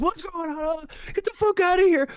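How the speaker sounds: high, loud panicked yelling; imitating a sleepwalking roommate's terrified shout; retold scream. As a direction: scream it like you've been woken by an intruder. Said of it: "and then, like, screams".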